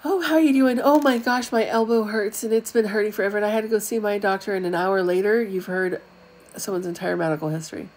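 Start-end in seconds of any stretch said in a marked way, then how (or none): 0.0-4.5 much higher, louder chatty moaner; eager whiny over-sharer launching into her ailments; complaining oversharer. Retold or imitated. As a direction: gush about your aches to anyone who'll listen. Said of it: "I've been this person".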